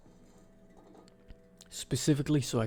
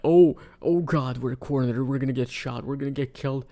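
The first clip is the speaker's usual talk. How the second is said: mock panic; 3 semitones higher, 7 dB louder than usual. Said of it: "and I was like".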